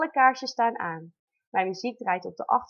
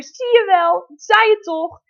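much higher, much louder told-you-so yell; triumphant shout; 10 semitones higher, 11 dB louder than usual. Retold or imitated. Wife shouting to husband that she was right, triumphant yell. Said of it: "Ik schreeuw als eerst naar je vader".

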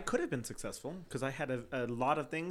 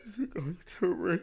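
mock-tearful frightened question; hammed-up fear; 3 semitones higher than usual. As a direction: fake being on the verge of tears. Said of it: "there was enough fear in my voice".

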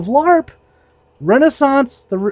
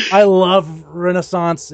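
ordinary delivery

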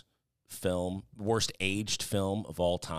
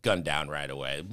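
ordinary talk

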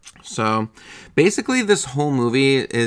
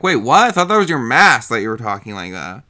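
surprised; 6 dB louder than usual. Retold or imitated. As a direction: blurt it out in disbelief. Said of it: "I'm like".

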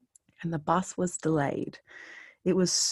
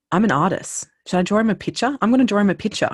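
louder, higher, eager; eager offer; 5 semitones higher, 7 dB louder, faster than usual. Retold or imitated. An artist girl on the bus eagerly offers to draw him a picture. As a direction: jump at the idea.

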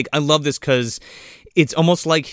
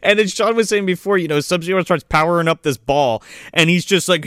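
ordinary talk

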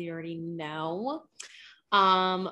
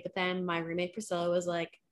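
ordinary talk, nothing marked

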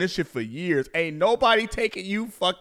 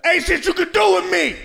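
much higher, louder bratty defiance; mock defiant kid shout; 11 semitones higher, 6 dB louder than usual. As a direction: shout it high and loud like a bratty child. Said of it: "that's how I sound when I be whipping my kids' ass".

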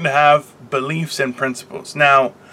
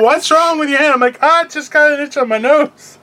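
much higher, mock-innocent voices; playful retelling; 11 semitones higher than usual. Retelling the teller's question and his excuse, pitched up. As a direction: bounce the lines up high and jokey. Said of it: "And she's like".

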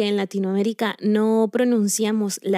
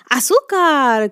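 long, loud, stretched "¡Azúcar!"; [name]'s cry; 5 semitones higher, 7 dB louder, slower than usual. Retold or imitated. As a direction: belt it out slowly like the salsa singer. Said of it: "como decía [name]".

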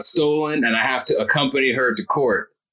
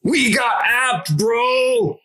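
realizing shout; 9 semitones higher, much slower than usual. Acted. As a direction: shout it as it dawns on you. Both realize they got fooled.

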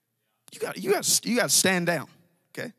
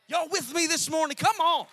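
rallying call; 9 semitones higher than usual. Real, not acted. Preacher rallying congregation.

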